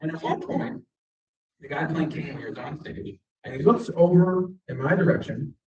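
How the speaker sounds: normal talking voice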